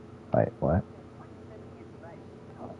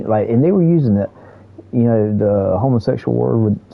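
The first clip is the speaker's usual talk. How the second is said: normal talking voice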